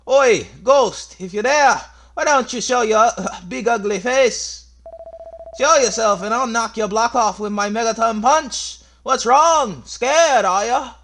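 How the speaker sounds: put-on high, shouted challenge in Scottish accent; aggressive Scottish accent. Daring the ghost to show itself, shouted Scottish accent. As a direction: taunt at full volume in a Scottish accent. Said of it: "this aggressive, like, Scottish accent".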